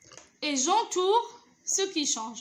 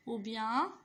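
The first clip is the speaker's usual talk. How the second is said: ordinary talk, nothing marked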